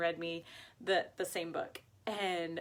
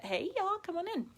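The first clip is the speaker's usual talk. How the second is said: high singsong greeting; welcoming call; 11 semitones higher, faster than usual. Real, not acted. Host greets viewers joining the live, high welcoming call. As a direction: call them in high and light.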